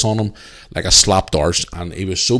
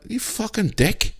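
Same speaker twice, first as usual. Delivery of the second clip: drawn-out insult; 13 semitones higher, 5 dB quieter, slower than usual. Retold or imitated. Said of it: "And I went".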